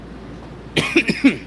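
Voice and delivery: real cough to clear the throat; short throaty cough. Real, not acted. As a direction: clear your throat mid-talk. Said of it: "clearing out my throat here".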